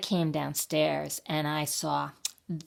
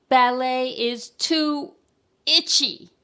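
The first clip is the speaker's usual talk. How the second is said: high, slow, loud whine; very loud complaint; 8 semitones higher, 7 dB louder, slower than usual. A narrator's reading. Child at her ballet lesson, very loud complaint. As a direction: draw it out and belt the last word. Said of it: "But I was very loud".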